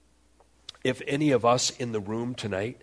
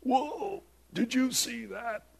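high, slow astonished gasp-talk; mock amazement, high and slow; amazed; 13 semitones higher, slower than usual. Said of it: "the crowd going".

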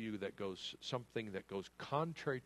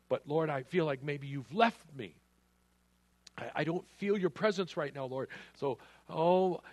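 pleading, higher and louder, voicing a worried believer; anxious believer; 7 semitones higher, 7 dB louder than usual. Preacher voicing a doubting believer calling out to the Lord, anxious plea. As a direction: cry out like someone who feels abandoned.

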